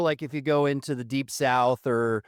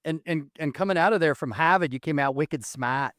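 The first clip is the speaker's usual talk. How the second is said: put-on Boston accent, dropped R's; wicked Boston townie accent. Host teasing guest about his Harvard schooling, in a Boston accent. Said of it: "your Boston accent".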